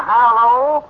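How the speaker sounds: long, high, loud hello; phone greeting. Acted. Storekeeper answering the phone, calling out slowly. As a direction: holler a drawn-out hello down the line. Answering the party-line telephone.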